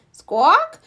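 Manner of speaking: high, loud bird squawk; parrot squawk. A narrator's reading. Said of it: "Polly opens her beak".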